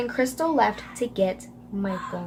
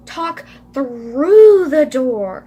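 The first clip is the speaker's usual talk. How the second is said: "through" stretched long, swelling loud; exaggerated emphasis; 3 semitones higher, 11 dB louder, much slower than usual. Shown as a demonstration. Crystal instructs Emily, heavy drawn-out stress on "through". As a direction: lean hugely on "through", very slow. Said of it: "I literally put emphasize in parentheses".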